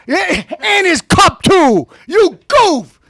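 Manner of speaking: a loud, slow jeer; loud taunt. Acted. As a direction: jeer at him loudly. Insulting Bill Maher.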